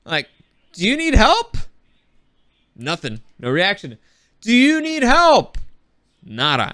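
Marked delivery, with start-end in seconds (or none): 0.7-1.6 loud, high shouted question; calling out to someone who won't answer; yelling out. Retold or imitated. Shouting to the silent woman on the shore. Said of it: "I yell out at her". 4.4-5.6 loud, high and slow, each word stretched; calling out again, drawn out and insistent; yelling out. Retold or imitated. Shouting again to the unresponsive woman in the waves. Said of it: "I yelled in English and in Spanish".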